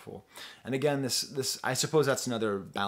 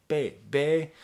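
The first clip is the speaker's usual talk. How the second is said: imitating a kid saying bébé; 4 semitones higher, 6 dB louder than usual. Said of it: "that little French kid says baby".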